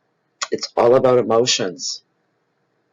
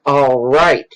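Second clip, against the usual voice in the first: louder, higher acted "all right"; exasperated giving in; 4 semitones higher, 5 dB louder than usual. Psychic to her insistent inner message, exasperated surrender. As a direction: give in loudly to the nagging voice.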